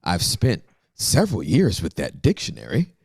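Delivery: normal talking voice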